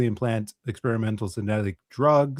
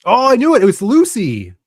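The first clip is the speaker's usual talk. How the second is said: excited; 11 semitones higher, 11 dB louder than usual. Real, not acted. Guessing the movie right.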